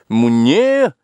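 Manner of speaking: high indignant shout; indignant cry. Acted. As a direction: bristle and cry out. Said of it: "возмущённо крикнул".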